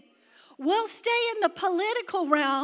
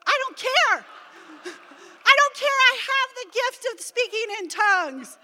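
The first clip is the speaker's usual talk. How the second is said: higher, louder, laughing defiance; defiant glee; 7 semitones higher, 6 dB louder than usual. Retold or imitated. Wife answering husband's motorboat joke, defiant. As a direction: toss it off happily, undeterred.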